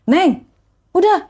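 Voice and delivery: imitated older man. Stranger interrupting the crying courier, imitated insistence. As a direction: voice a passer-by cutting in on the crying. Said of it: "ada bapak-bapak yang bilang".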